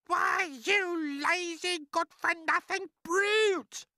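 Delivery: very high, slow, shrieking shout. Acted. Aunt berating the pleading boy, high angry shout. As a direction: shout the insult, dragging out every word. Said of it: "Aunt Spiker shouted".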